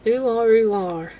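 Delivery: loud Wiltshire farmer "Ooh, are"; broad Wiltshire burr, hammed up; West Country farmer accent. Shown as a demonstration. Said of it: "my accent is farmer".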